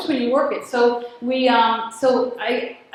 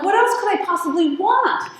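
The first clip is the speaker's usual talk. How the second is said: exasperated outburst; 7 semitones higher than usual. Retold or imitated. Pushed to the limit, exasperated.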